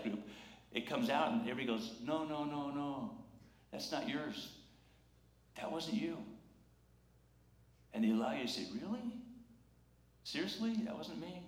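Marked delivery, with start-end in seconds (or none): 2.0-6.2 soft, tender comforting voice; gentle reassurance. Acted. Friends reassure someone carrying guilt. 8.7-11.4 high, soft questioning voice; hushed disbelief. Acted. Guilty person hears they are blameless.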